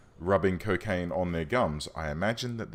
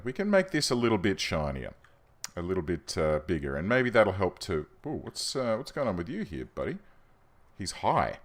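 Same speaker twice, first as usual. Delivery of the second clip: ordinary talk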